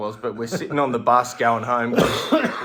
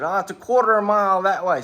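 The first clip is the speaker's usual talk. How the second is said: higher, louder, quick breezy reply; mock helpful stranger; 8 semitones higher, 7 dB louder, faster than usual. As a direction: rattle off the same directions. Third stranger, same answer.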